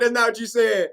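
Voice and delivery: That's not what you said, high and loud; retold angry protest. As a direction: son shouting back to correct mom's story. Arguing back at mom, retold.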